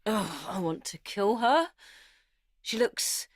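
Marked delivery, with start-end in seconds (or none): none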